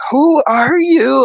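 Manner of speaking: high, slow, loud; starstruck slow who. Retold or imitated. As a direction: stretch it out in awe. Retold starstruck question.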